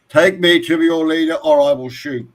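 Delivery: hammed-up commanding threat; threatening alien captain. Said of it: "Turns to the gas pump and says".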